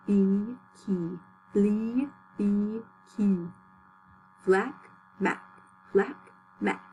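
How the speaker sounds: evenly paced nonsense syllables with gaps; measured lip warm-up chant; articulation drill. Shown as a demonstration. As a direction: sound out each syllable deliberately for the class. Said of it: "You say it with me".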